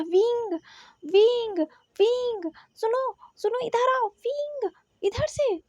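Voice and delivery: high, drawn-out calls of a name; urgent calling shout. Acted. Niohseng shouting to Wing through the crowd, high urgent shout. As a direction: call out to get someone over here. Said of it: "न्योहसेंग चिल्ला रहा था".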